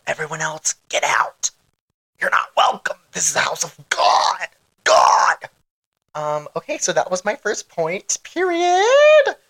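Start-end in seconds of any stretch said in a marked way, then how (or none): 0.1-4.5 dramatic whisper. Acted. Mock sermon banishing listeners. 4.8-5.4 loud. Acted. Mock sermon climax. 8.2-9.4 high shriek. Acted. Emphatic ending of point.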